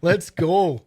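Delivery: loud, put-on Minnesota accent; big mock Minnesota send-off. Host jokingly wrapping up the show, loud Minnesota accent. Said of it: "You just do your Minnesota accent".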